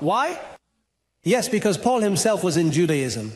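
usual speaking voice

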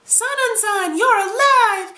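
very high, drawn-out hysterical wail; hammed-up whiny crying freak-out; Ito. Reviewer acting Ito learning Sanan lives, whiny mock hysterics. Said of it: "starts freaking out".

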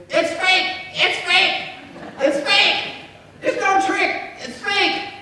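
high, loud squawking parrot voice, far from his own. Acted. Ornery parrot heckles the magician's show, high loud squawk. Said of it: "the parrot would say".

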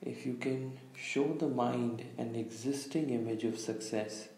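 calm meditation guide, long pauses between phrases; unhurried, slightly hushed meditation delivery; guided visualization. Real, not acted. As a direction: lead listeners gently inward, leaving space after each instruction. Leads a guided visualization exercise.

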